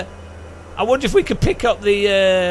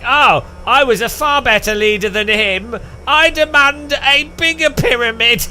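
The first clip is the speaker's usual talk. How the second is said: higher, slowing into a haughty demand; pompous pharaoh; 7 semitones higher, 4 dB louder than usual. Retold or imitated. Next pharaoh demanding a bigger pyramid from the builders, haughty. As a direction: puff up like a vain ruler. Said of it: "next guy was like".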